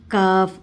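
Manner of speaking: काफ़ said plain and forward, loud; a front काफ़ like an ordinary k; wrong light kaf. Shown as a demonstration. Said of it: "ये भी नहीं आएगा". (काफ़ सामने से, गलत तरीक़ा)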